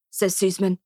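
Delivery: plain reading voice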